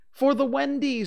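put-on very high, loud boyish answer; high, emphatic child's voice; eager reply. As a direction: pipe up loudly with the obvious reason. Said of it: "said Curly".

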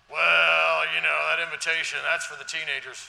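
loud mocking voice of a dismissive member; dismissive churchgoer. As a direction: shout it out like a brushing-off skeptic.

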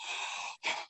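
soft imitated gasp; amazed gasp. Mom hearing son speak clearly, amazed gasp. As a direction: draw in a breath, astonished.

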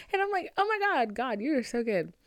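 high, giddy gushing. Real, not acted. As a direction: gush like the gift made your day. High gushing after laugh.

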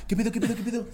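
agudo, bajito y repetido; sobresalto fingido. Acted. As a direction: imita a alguien pillado y desconcertado en voz baja. Reacción actuada del compañero sorprendido. (susto cómico, quedito)

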